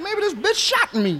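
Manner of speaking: like you just got knocked silly; stunned disbelief. Retold or imitated.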